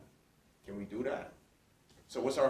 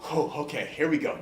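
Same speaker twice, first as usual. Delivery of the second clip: ordinary talk, nothing marked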